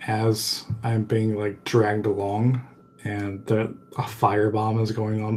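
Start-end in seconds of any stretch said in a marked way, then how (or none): none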